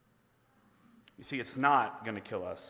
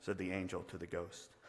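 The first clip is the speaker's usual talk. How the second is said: normal talking voice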